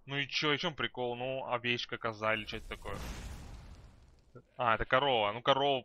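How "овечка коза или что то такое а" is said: ordinary talk, nothing marked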